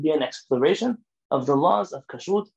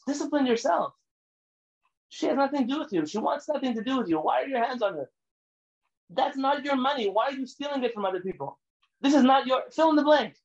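higher, rising to a shout at the end; scolding; 7 semitones higher than usual. Acted. Preacher scolding people who cross boundaries, building anger. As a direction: rebuke them, building to a shout.

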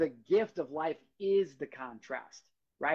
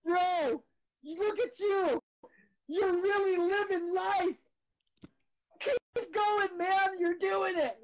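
much higher, slow, tearful encouragement; higher self cheering on his crying self; cheering inner observer; much slower than usual. Retold or imitated. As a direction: hype yourself up like a proud friend, voice cracking. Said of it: "the conscious observer in me is actually standing up here going".

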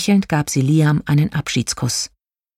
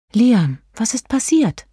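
slow, careful question, pitched up; tentative concern in a higher voice; cautious; 8 semitones higher than usual. A narrator's reading. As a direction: ask gently, feeling your way. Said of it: "fragte Prinzessin Lilifee vorsichtig".

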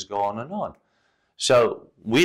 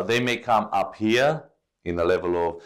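ordinary delivery

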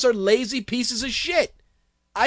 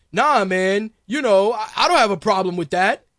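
mock defensive; 4 semitones lower, 4 dB louder than usual. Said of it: "when you get called out on it".

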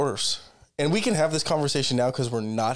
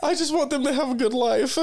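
higher, laughing mock dying wish; mock deathbed drama; 6 semitones higher than usual. Teasing friend mocks her deathbed plea with a laugh. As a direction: ham up a tragic last request. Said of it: "you're on your deathbed".

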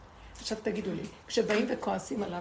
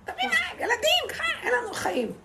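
much higher, half-laughing whiny wail; mock whining complainers; 4 dB louder than usual. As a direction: imitate the people crying out for water. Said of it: "הם באים וצועקים".

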